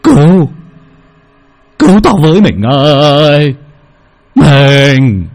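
loud, strained cry for his wife; terrified plea. Acted. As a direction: beg for help in panic, voice cracking. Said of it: "mắt nhắm tịt lại mà lẩm bẩm". (kêu cứu vợ hoảng loạn, to và thảng thốt)

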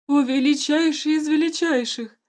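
high loud pleading plea; begging. A narrator's reading. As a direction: beg for mercy on your knees. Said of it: "взмолился начальник охраны".

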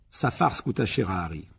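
plain reading voice

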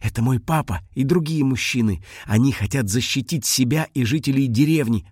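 ordinary delivery

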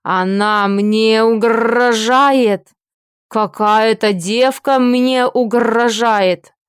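loud, slow outraged orc bellow; guttural rough orc roar. Acted. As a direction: roar it out slowly in orc fury. Orc enraged, shouting.

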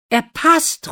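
high, loud exclamation; excited cry. A narrator's reading. Prince sees the shoe fit Lina, crying out excitedly. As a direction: cry it out in joyful amazement. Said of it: "ruft der Prinz".

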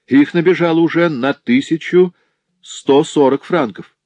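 high, loud bailiff reeling off the debt; brash bailiff. Acted. Пристав предъявляет счёт. (наглый пристав, высоко и громко)

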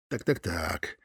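low, soft musing; muttering to himself. Acted. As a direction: murmur it, intrigued. Лёня muses at screen.

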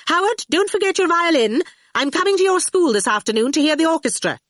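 high, fast hurried call. Acted. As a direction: call it while rushing past. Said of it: "calling out".

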